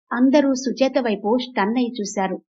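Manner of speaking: plain reading voice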